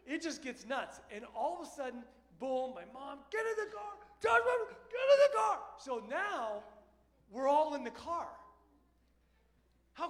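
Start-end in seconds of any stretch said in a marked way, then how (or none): 3.3-5.6 much higher, screaming at her son; furious shout. Retold or imitated. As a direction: mom roaring at Joshua to get in the car. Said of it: "Godzilla comes out of the door".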